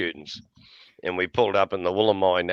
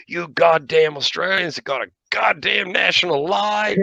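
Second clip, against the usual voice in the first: indignant Yank; 9 semitones higher than usual. Retold or imitated. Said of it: "this one Yank jumps up and he goes".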